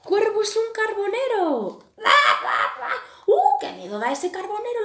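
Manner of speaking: high, loud, half-laughing cries of fear; the birds flee the painted crow with loud high cries; frightened birds. A narrator's reading. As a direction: squeal it like startled little birds.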